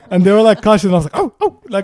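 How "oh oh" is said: laughing high. Retold or imitated. Mock alarm with laugh.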